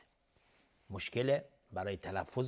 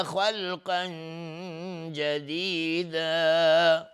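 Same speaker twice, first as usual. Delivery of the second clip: slow, high chanted recitation of the verse; Quran recitation; 6 semitones higher, 8 dB louder, much slower than usual. Shown as a demonstration. Teacher reciting a verse to the class, slow and chanted. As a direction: recite it slowly in full tajwid style.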